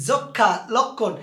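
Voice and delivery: যক্ষা and লক্ষণ drawn out, slow; ধীরে উচ্চারণ; slow example words. Shown as a demonstration. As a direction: spell out the exceptions slowly. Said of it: "এগুলো আবার ব্যতিক্রম".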